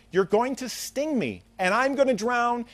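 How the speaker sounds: acted frog objecting, pitched high; high-pitched storyteller's protest; worried protest.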